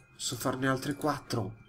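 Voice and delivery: usual speaking voice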